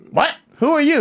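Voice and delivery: high startled who are you; snapping back in disbelief; mock-offended high retort; indignant what. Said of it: "she just goes".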